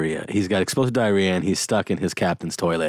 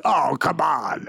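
mock exasperation; 7 semitones higher, slower than usual. Acts out exasperated audience reaction.

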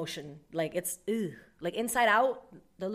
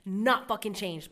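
louder, higher exasperated rant; fed-up raised voice; exasperated outburst; 4 semitones higher, 8 dB louder than usual. Retold or imitated. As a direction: vent like you've had enough. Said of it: "I'm like".

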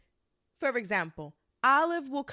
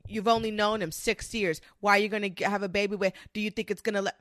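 lower, fast mocking voice of a nosy naysayer; mock disapproving Olive; 3 semitones lower, faster than usual. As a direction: parody a judgy friend who stammers her doubts. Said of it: "You really think I'm gonna come like".

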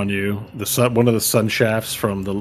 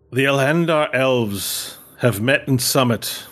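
raised, formal ceremonial address; solemn elven elder; 4 semitones higher than usual. Acted. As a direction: proclaim like a council elder. GM voices elven council leader.

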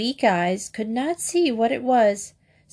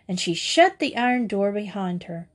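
ordinary delivery, nothing marked

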